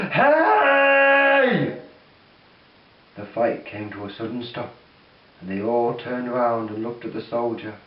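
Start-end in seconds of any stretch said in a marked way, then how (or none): 0.1-1.8 loud, high shout; scream. Acted. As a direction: yell to stop the fight.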